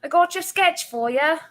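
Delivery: high, put-on English accent, lightly hammed; cheerful mock English accent, sweetly offering a sketch; terrible English impression. Friend imitates the artist's English accent, handing over a sketch. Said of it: "that was a terrible impression".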